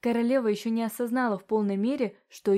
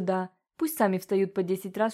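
usual speaking voice

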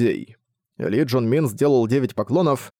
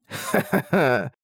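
slow gloating laugh; much slower than usual. Acted. Orc laughs after the kill.

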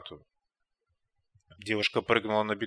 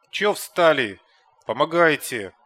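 loud strained yelling; straining shout; 4 semitones higher, 9 dB louder than usual. A narrator's reading. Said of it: "надрывался Кондор". (надрываясь, кричит)

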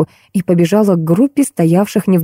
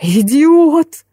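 high, drawn-out cry of outrage; shrill boss crying out an insult; yelling; 9 semitones higher than usual. A narrator's reading. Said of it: "закричала Карина Карловна". (возмущённый высокий крик)

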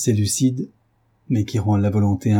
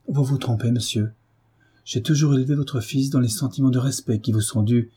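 ordinary delivery, nothing marked

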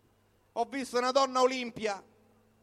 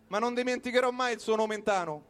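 ordinary talk

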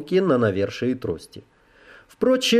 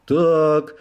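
reproachful; 10 semitones higher than usual. Acted. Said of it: "укоризненно начал он".

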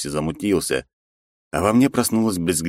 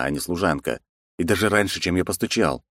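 normal talking voice

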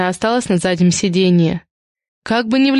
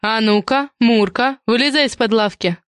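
usual speaking voice

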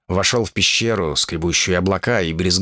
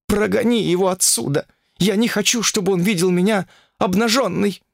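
frantic fox princess; 9 semitones higher than usual. Acted.

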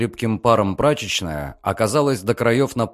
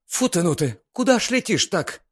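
startled maid; 9 semitones higher than usual. Acted. Startled maid scolds boy.

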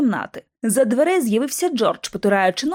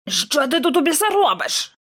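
higher-pitched, rasped question; hoarse demand; 5 semitones higher than usual. A narrator's reading. Kipps croaks at Lockwood after their collision, hoarse demand. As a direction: croak it out, indignant. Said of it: "прохрипів Кіпс".

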